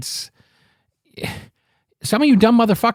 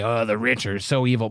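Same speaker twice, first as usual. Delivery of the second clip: mocking fake piety; 7 semitones lower, 6 dB quieter than usual. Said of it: "rich fucks that come out and say".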